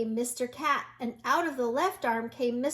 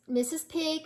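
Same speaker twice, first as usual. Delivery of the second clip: ordinary delivery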